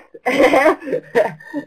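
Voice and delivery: bright burst of real laughter at the right answer. Real, not acted. Trainer laughing as the chat answers her quiz, real laugh. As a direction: laugh out loud, delighted.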